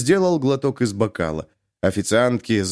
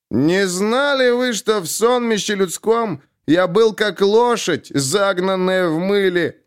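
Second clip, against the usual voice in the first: high, slow wailing of the verse; wailing recital; 8 semitones higher, slower than usual. A narrator's reading. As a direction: howl the poem into the mic. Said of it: "он снова заголосил в микрофон". (пьяный голосит стихи в микрофон)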